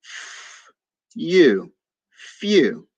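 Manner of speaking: f hissed unvoiced, then u, then few blended; sounding out letters. Shown as a demonstration. Teacher modeling phonics for virtual villagers, sounding out. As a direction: stretch each sound, then join them. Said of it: "Next word".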